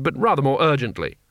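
ordinary delivery

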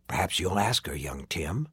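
low, soft villain reply; sly Covenant man. A narrator's reading. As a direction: answer the boy evasively, voice dropped. Covenant man's sly answer.

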